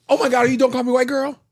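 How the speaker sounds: high, fast, gushing fan; excited fan voice. Shown as a demonstration. Practising a fan greeting on request, gushing high and fast. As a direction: gush like a starstruck fan. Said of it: "All right, practice".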